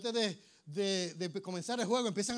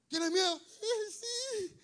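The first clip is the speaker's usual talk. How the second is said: very high, thin mock fright; voice of fear; 14 semitones higher, much slower than usual. Asked whether you are afraid, high fearful squeak. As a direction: squeak it out as if scared. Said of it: "la voz del miedo".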